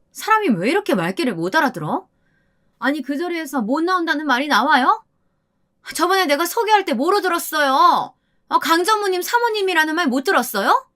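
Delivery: high-pitched irritated telling-off; sharp, incredulous reprimand in a high voice; indignant scolding. A narrator's reading. Wife scolds narrator after grabbing arm.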